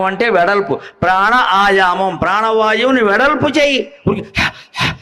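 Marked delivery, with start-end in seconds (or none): 1.0-2.2 ప్రాణ, ఆయామం slowly as two separate words; word split in two. Shown as a demonstration. 4.1-4.9 imitated panting, voiceless; short puffed breaths. Shown as a demonstration.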